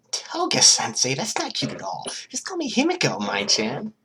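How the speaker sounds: put-on high, bubbly girl voice; a giddy, dangerous schoolgirl; Toga's voice. Toga testing names on a new friend, high and giddy.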